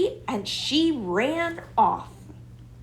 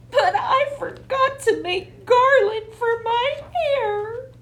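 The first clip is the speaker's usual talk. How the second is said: much higher squeaky creature voice, weeping; a tiny weeping bride squeaking through tears; sobbing squirrel bride; 14 semitones higher than usual. A narrator's reading. Squirrel bride sobs to the children about her wedding, weeping. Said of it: "sobbed the squirrel bride".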